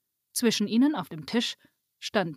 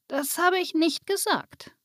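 correcting; 8 semitones higher than usual. A narrator's reading. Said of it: "korrigierte Waltrudes".

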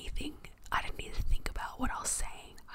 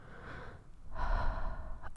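long unvoiced sigh; whispered sigh. Real, not acted. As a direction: breathe out slowly after holding breath. ASMR, out of breath.

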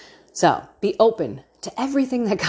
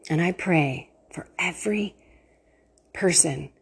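a soft whisper, breath only; whispered prayer; 4 dB quieter than usual. Real, not acted. Praying for listeners.

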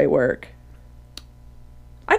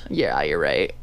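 deep mock voice; 6 dB quieter than usual.

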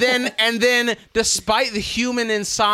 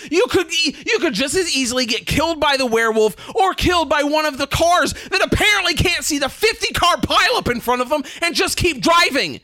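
exasperated, higher-pitched ranting; indignant comic tirade, pitched up; worked-up rant; 5 semitones higher than usual. Real, not acted. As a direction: rail at foolish pedestrians, getting carried away. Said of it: "Oh, I am so worked up".

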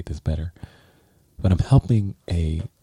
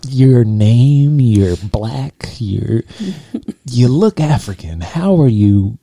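imitating her loud, higher, baffled struggle; mock flustered questions, louder and higher; struggling woman; 5 semitones higher, 9 dB louder, slower than usual. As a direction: play her as confused and insistent. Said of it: "struggling to".

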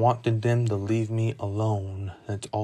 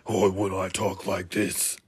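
put-on cartoon character voice; Pete; 2 semitones lower than usual. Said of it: "I did the voice of Pete".

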